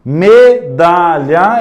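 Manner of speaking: medalha drawn out slowly; sounded-out medalha. Shown as a demonstration. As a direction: stretch medalha out. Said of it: "A letra D". (medalha bem devagar)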